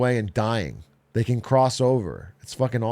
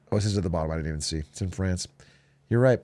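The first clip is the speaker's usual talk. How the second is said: normal talking voice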